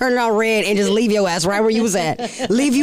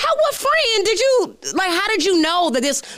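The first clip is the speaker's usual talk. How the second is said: alarmed confrontation; 9 semitones higher than usual. Retold or imitated. Confronting stalker who tracked her phone.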